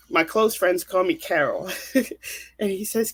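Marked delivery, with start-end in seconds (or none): 1.7-2.5 soft breathy laugh. Real, not acted. Laughs at own nickname.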